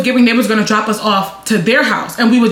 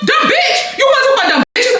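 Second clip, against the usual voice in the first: very high mock-outraged cry; indignant comeback; 14 semitones higher than usual. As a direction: throw the insult back in a shrieky pitch. Retold angry comeback in quarrel.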